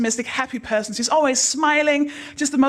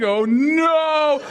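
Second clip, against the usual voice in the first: loud, long-drawn mock horror; raised, drawn-out cry of dismay; slow-motion cry; 4 semitones higher, 5 dB louder than usual. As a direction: stretch it out like a slowed-down film. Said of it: "happen in slow motion".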